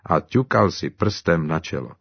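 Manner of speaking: usual speaking voice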